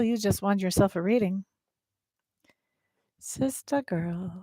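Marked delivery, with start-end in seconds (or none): 3.2-4.4 savoring the name. Real, not acted. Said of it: "I just like saying your name".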